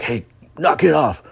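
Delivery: high mock-tough warning; mock tough guy. Retelling the angry entourage, a mock tough warning. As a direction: imitate the entourage telling the puppet off.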